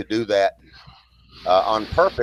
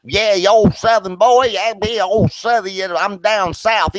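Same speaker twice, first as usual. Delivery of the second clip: mocking Southern voice; 10 semitones higher, 4 dB louder than usual. Ronan's mate mocking the Southern speaker, high loud mockery. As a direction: sneer like a bully mocking a Southerner. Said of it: "He'll go".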